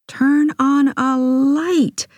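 high, drawn-out demand from a mother; exasperated mother. A narrator's reading.

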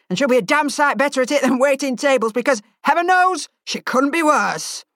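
hammed-up moaning chef; sarcastic grumbling chef. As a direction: grouse about the clumsy lass.

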